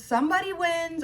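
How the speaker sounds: usual speaking voice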